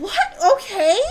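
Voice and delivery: high, incredulous questioning; retold disbelief. Storyteller replaying her answer to the prank theory, incredulous. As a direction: imitate being baffled, pitch jumping way up.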